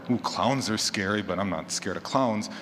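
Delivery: usual speaking voice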